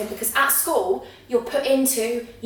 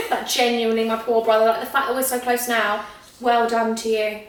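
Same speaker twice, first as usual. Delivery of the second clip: ordinary talk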